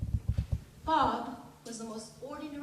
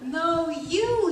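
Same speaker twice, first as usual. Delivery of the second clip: insistent lover; 5 semitones higher, 11 dB louder than usual. Acted. Acted lover insisting on seeing her.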